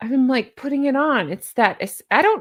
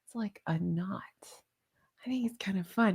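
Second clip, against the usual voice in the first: hushed whisper; breathy aside to viewers; 3 semitones lower, 11 dB quieter than usual. Real, not acted. Whispering to viewers about the necklace.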